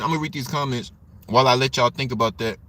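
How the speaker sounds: ordinary delivery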